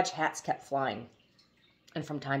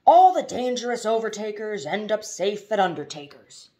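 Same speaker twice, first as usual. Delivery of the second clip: road sign rhyme; 5 semitones higher, 9 dB louder than usual. A narrator's reading. Reads a painted road warning.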